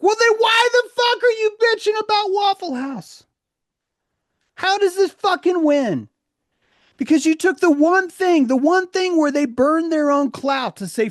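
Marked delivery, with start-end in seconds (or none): 0.0-7.6 high, loud exasperated shout; angry yell. Real, not acted. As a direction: yell it in disbelief. Angry rant continues.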